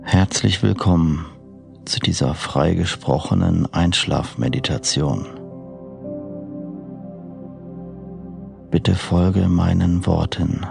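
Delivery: soft, slow sleep meditation voice; guided meditation. Acted. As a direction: lull the listener toward sleep gently. Freigesprochene Einschlafmeditation.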